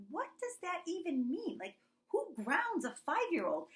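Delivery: high-pitched mock disbelief; incredulous self-questioning. Retold disbelief at her own threat.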